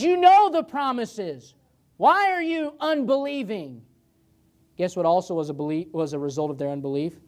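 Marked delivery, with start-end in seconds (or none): 0.0-3.8 higher, louder, slowed rebuke; Jesus rebuking. Retold or imitated. Jesus rebuking unbelieving disciples, raised rebuking voice. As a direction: scold them like a disappointed teacher.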